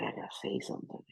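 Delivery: fast whisper to self; whispered thought. Retold or imitated. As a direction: whisper it quickly in your head.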